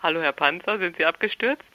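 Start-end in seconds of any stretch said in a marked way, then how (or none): none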